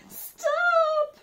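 put-on high, loud shout of an old woman. A narrator's reading. Old woman shouting at the gingerbread man to stop. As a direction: yell after the runaway. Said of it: "shouted the old woman".

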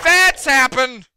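high, loud stretched-out holler; startled yell. Real, not acted. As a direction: shout it slowly in alarm. Startled by game event.

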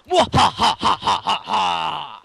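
slow, loud, high evil cackle; booming villain laugh, drawn out; mad scientist laugh. Acted. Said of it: "It's really hard to do that".

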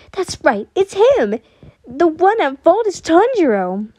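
high, loud accusing outburst; triumphant, angry realisation; furious blame. A narrator's reading. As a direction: pin the fault on a rival. Character seizes on someone to blame.

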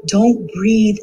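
usual speaking voice

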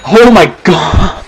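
loud, slow astonished exclaim; surprised shout. Real, not acted. Gamer shouting at a sudden one-hit kill.

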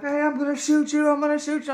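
high, loud, urgent warning; acted high-pitched threat; shouted police threat. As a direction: imitate a panicky officer yelling. Officer repeats threat to shoot.

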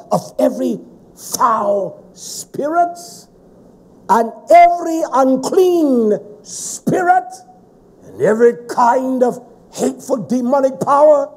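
loud, high, emphatic sermon delivery; full-force preaching. Acted. Preacher thundering at the congregation, full force.

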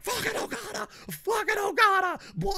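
mock wrestling-fan yell; ranting yell. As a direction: rave at the top of your lungs like a madman. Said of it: "if yelling's not your thing and fucking ranting and raving's not your thing".

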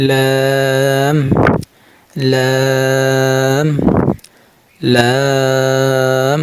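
লাম drawn out very long, one slow even note each; demonstrated letter. Shown as a demonstration. Teacher holding the letter লাম for students. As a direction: stretch out লাম slowly. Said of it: "আগে উচ্চারণ করতে হবে".

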